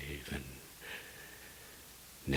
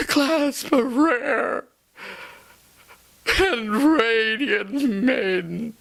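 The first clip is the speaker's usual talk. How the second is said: high and loud, crying out; sobbing shout; 14 dB louder than usual. Acted. Lover crying out to the raven about Lenore. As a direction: plead through tears.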